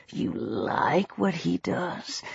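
low, very soft, slow taunt; hushed, lazy menace; lazy drawl. A narrator's reading. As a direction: drawl it quietly and insinuatingly. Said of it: "He drawled the word, his voice lazy".

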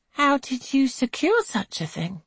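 high, eager question; lightly voiced curious girl; curious young woman. A narrator's reading. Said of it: "asks Angelica".

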